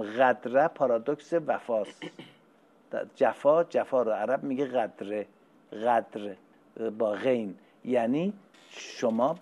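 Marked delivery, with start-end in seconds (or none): none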